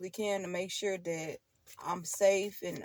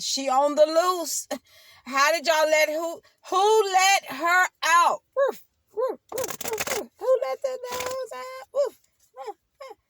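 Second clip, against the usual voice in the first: high, loud mocking sing-song of her kids; 9 semitones higher, 6 dB louder than usual. Kids joking that their mama is on the loose, with high mocking barks. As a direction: bark it out high like a teasing kid. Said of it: "That's what they be saying about me".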